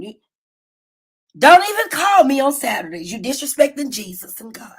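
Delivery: normal talking voice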